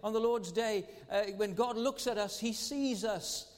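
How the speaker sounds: fervent preacher's climax; preaching at full force. Acted. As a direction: proclaim it to the congregation, slowing on he sees us.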